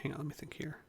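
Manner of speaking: whispered aside to himself. Real, not acted. Game master stalling to think, whispered aside. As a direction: mutter it under your breath.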